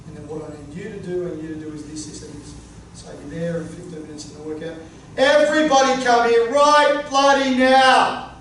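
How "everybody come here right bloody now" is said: angry coach bellowing at the squad; full-volume angry coach summons; loud confronting yell. Shown as a demonstration. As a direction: roar it at the whole group. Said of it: "it's gonna be loud and it's gonna be confronting".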